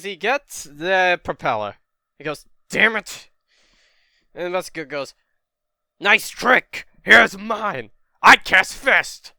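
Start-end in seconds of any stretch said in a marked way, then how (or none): 2.7-3.3 loud, high outburst of frustration; acted burst of annoyance; frustrated curse. Retells character's frustrated curse. 6.0-9.3 loud, high villain battle cry; mock-heroic shouted taunt. Acts villain attacking with a punch.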